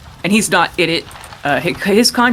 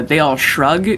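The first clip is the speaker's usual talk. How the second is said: ordinary delivery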